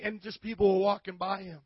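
ordinary talk

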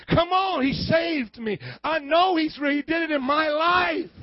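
loud, high, fiery preaching cry; fervent testimony. Real, not acted. Preacher testifying to the congregation, fervent shout. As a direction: shout his own rescue out to the church.

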